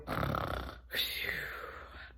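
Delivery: long whispered sleepy sigh. Acted. Lazy dog sighs into his nap, whispered. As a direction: sigh like a dog settling to doze.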